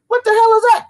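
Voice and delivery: high, loud mock startled squeal; hammed-up startled cry in a high voice; mock alarm. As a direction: squeal it like a spooked homebody. Acting out people hiding at home.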